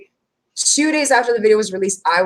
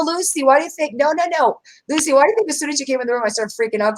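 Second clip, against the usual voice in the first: ordinary talk, nothing marked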